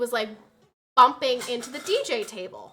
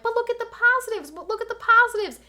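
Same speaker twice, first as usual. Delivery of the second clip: mocking cheerfulness; 11 semitones higher than usual. Mocking well-meaning guests.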